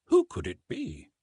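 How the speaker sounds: ordinary delivery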